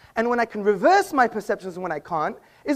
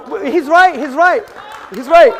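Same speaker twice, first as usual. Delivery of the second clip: building from loud to a full mock shout; hammed-up agreement, getting louder each time; 5 semitones higher, 9 dB louder than usual. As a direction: play along with the heckler, shouting it out.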